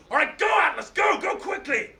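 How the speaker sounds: high, loud hurry-up call; urgent shout. Acted. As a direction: push him to go. Hercules urging Atlas away.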